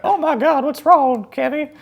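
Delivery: high, loud, fretting grandma impression; put-on shrieky concern; worried grandma. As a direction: falsetto grandmother alarmed for a crying child. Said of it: "my grandma just heard me cry, and she's like".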